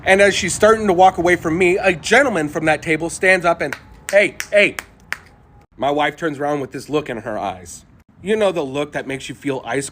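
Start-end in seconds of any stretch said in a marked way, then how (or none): 4.1-4.8 loud, higher call to get her back. Retold or imitated. Diner calling after the waitress walking away.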